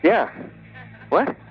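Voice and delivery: ordinary talk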